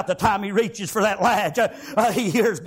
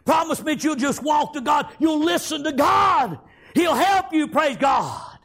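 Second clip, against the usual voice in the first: shouted exhortation; 7 semitones higher than usual. Acted. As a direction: press the promise like a preacher. Grandfather urging the boy.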